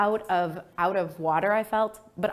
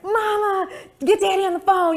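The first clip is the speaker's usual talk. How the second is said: excited call; 13 semitones higher, 8 dB louder than usual. Retold or imitated. Calling mom with law news.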